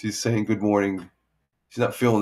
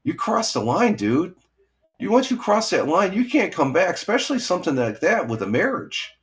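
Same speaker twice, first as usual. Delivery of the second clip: raised, higher-pitched retold scolding; heated telling off; 9 semitones higher than usual. Telling off the sister's ex-husband in the base office, heated. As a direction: lay into him all over again, pitched high. Retelling confronting the brother-in-law.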